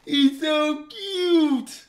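slow, high, sing-song coo over a pet. Real, not acted. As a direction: melt over the cute cat. Cooing over his cat.

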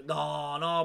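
ordinary talk

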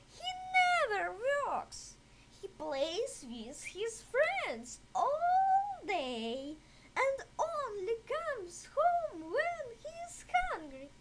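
a fussing mother cutting in; mother's voice. A narrator's reading.

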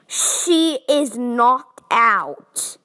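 very slow, each word of "She is knocked out" drawn out and punched; exasperated emphasis. Acted. Dreamer answering the clueless monster, exasperated, "She is knocked out" stretched slowly.

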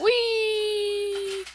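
high, stretched cry of glee; mock happy wee; gleeful wee. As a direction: sail down with a childish wee. Falling in game.

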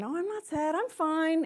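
lightly voiced parent saying she's fine; parent denying. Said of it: "And then".

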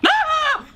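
very high, short shriek; startled scream. Real, not acted. Player attacked at the door, high startled scream. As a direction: shriek suddenly at a jump scare.

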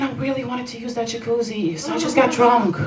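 ordinary talk

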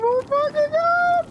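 high, drawn-out panicked cry. Real, not acted. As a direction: stretch it out in real panic. Gas closing, panic.

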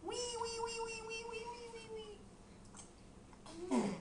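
very high, soft piggy wee; piggy squeal. Pretend. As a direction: squeal like a little piglet. Piggy sound.

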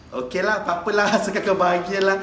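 ordinary delivery

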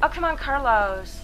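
fed-up plea; exasperated. Real, not acted. Gamer begging Carlos to succeed, exasperated. Puzzle failing again.

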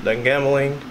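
normal talking voice

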